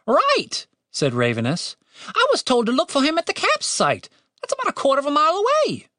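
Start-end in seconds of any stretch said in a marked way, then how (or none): 0.1-0.7 high-pitched British bird voice; little bird, pitched way up; talking bird. A narrator's reading. Bird agrees. 2.1-5.9 high-pitched British bird voice; brisk little bird, pitched way up; helpful talking bird. A narrator's reading.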